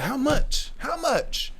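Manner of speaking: high, squeaky disbelieving how much; shocked asking price. Retold or imitated. Boy shocked at pill price, high squeak. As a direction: yelp it out in disbelief.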